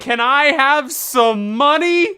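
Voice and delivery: high, drawn-out plea for a handout; begging. Acted. Player begs a character for money, high drawn-out begging. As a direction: stretch it out, pitched high, pleading.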